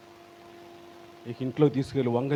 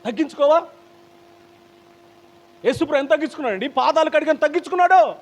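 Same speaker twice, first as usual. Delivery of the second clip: high, loud pulpit challenge; preacher at full force; forceful preaching; 12 semitones higher, 3 dB louder than usual. Real, not acted. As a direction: press the congregation hard.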